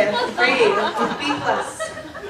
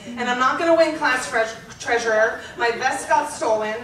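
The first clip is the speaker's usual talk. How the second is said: put-on building teenage rant. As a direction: pile up complaints until you burst. Diary rant listing teenage woes.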